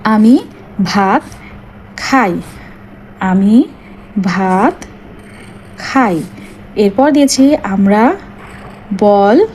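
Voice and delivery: each word set apart by long pauses; slow dictation. Shown as a demonstration. Teacher dictating sample sentences to pupils, slow and spaced. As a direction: dictate word by word so children can write. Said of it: "তো দেখো আমি কি করেছি".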